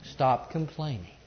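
ordinary talk

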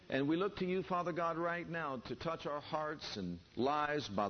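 earnest spoken prayer, hushed in the middle. Acted. Preacher praying over the congregation, earnest and hushed.

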